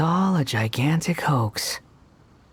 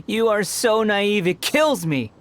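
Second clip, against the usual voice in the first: higher, slow, sneering; mocking scorn; 7 semitones higher, 3 dB louder, slower than usual. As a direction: savor how naive they are. Mocking the travelers.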